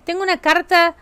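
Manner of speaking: usual speaking voice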